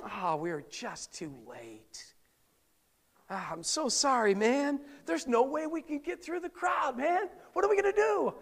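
high, hammed-up worried friend; comic friends' voice. Preacher voicing a friend stuck outside the crowd, hammed-up and high. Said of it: "That's how they talk back then".

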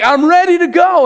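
fired-up volunteer; eager. Retold or imitated. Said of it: "you're like".

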